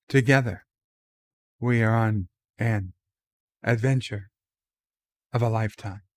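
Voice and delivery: halting, dramatic pauses between phrases, lightly voiced Shatner; a hint of Captain Kirk, word by word with pauses; William Shatner impression. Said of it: "to do his Shatner impression".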